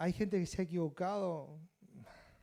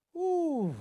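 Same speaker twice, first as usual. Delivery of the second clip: high, loud drawn-out "oof"; awed "whew"; 9 dB louder than usual. Acted. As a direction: exclaim a falling, hammed-up whew. Feigned awe at exorcism.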